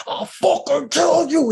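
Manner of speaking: high, loud, slow tearful threat; crying rage. Retold or imitated. A mother choking the man at her door, crying rage. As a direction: scream it through tears.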